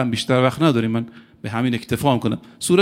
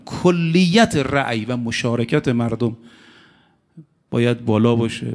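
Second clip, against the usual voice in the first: ordinary delivery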